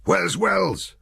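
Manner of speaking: loud, high urgent shout. A narrator's reading. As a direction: shout it over gunfire. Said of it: "he shouted".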